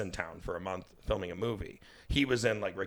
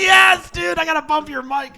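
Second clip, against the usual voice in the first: very high, very loud hyped shout; real high-pitched excited outburst; excited yell; 16 dB louder than usual. Real, not acted. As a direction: yell it out, thrilled. Excited yelling during storm chatter.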